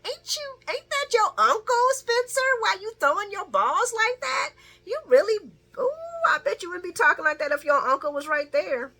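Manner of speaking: high, loud, sassy taunt; mock him with a high sassy voice; sassy high callout. Viewer taunting Spencer through the screen, sassy high voice.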